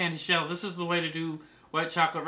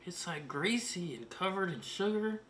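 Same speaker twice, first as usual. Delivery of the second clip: hushed ASMR voice; 9 dB quieter than usual. Acted. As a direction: murmur to the cake as if it were a secret. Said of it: "I don't know why I'm talking like this".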